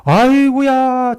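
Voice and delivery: high, loud, drawn-out cry of delight; joyful exclamation. Retold or imitated. Pastor overjoyed at the missionary's offer, slow and high. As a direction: stretch it out in glee.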